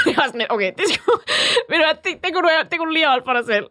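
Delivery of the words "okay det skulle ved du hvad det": usual speaking voice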